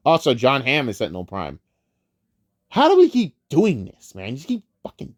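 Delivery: ordinary delivery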